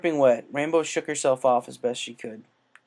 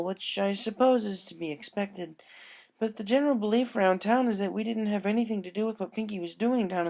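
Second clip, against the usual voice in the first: higher voice, relieved; Missus Cake; 9 semitones higher than usual. Acted. Baker telling a customer about business, relieved and higher. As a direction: explain it gently, pitched up.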